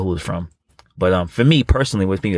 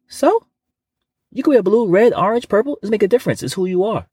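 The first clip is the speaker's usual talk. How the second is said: pitched-up defiant pep talk; high excited insistence; 10 semitones higher than usual. Acted. Defiant reply to imagined fear.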